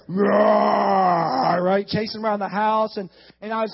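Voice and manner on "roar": long, loud mock growl; playful bear roar. Dad chasing kids around the house, roaring.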